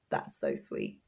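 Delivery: whispered aside; soft whisper of delight; hushed awe. Real, not acted. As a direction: breathe it out softly. Hushed reaction to gift.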